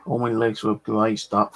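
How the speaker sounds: usual speaking voice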